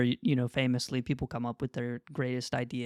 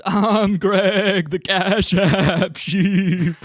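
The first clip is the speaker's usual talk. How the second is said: slow, drawn-out, loud cartoon sheep catchphrase; Greg the sheep voice; 5 semitones higher, 9 dB louder, much slower than usual. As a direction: stretch every word like a goofy sheep announcing himself. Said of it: "He goes".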